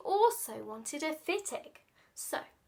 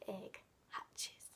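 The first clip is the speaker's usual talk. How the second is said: hushed whisper; 6 semitones lower, 16 dB quieter than usual. Acted. Teasing the surprise in blurb.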